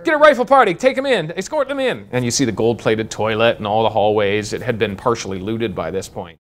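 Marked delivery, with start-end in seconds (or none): 0.0-2.1 much higher and louder barked orders; commanding general shouting. Retold or imitated. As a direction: order the sergeant around at full volume. General orders the sergeant around.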